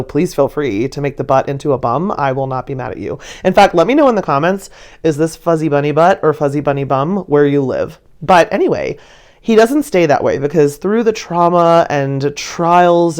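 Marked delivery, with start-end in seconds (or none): none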